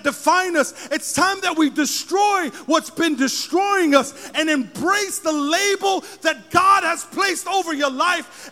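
loud, slow preaching, every line hammered; full-force preaching. Acted. Preacher proclaiming to the congregation, full-force preaching. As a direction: thunder it over the congregation.

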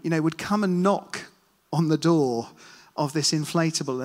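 ordinary talk

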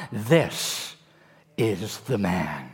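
low, slow prophetic pronouncement; deep solemn voice of the prophet. Acted. Samuel speaks as God's choice.

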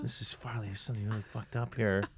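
hushed confession. Pretend. Son whispering to mom on phone.

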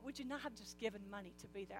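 ordinary talk

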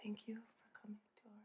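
soft fast breathy ASMR whisper. Pretend. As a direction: whisper it right in your ear. Mock ASMR after ASMR remark.

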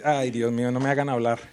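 ordinary delivery

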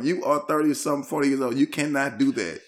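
normal talking voice

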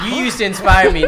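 mock disappointed fan. Voicing a let-down fan, joking.

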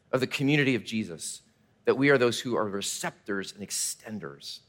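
ordinary talk, nothing marked

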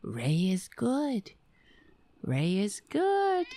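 slow, soft, sing-song comfort; soothing cat. Shown as a demonstration. Soothing the cat slowly. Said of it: "That's what I have to say all day".

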